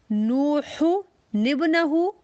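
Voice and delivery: नूहु निबनहु drawn out very slowly, joined in one breath; slow Quranic recitation. Shown as a demonstration. Quran teacher recites नूहु निबनहु slowly for sisters. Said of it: "जैसे".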